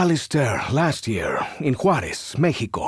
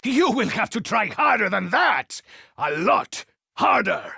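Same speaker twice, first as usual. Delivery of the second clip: normal talking voice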